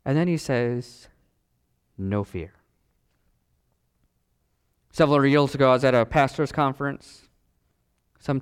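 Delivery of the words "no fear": low, hushed word of comfort; reassuring. Acted. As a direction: drop low and soft to still the panic. Voices Jesus calming the panicked disciples.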